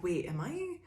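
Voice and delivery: high, quick puzzled voice of the manipulator; mock confused narcissist. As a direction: play the gaslighter suddenly losing the plot. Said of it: "they're like".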